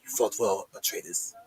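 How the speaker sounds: low-volume murmur fading to a whisper; quiet, acted last words to an opponent; hushed tribute. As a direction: speak softly to a foe you just killed. Acted victor to dying opponent.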